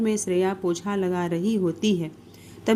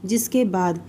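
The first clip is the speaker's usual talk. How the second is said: ordinary delivery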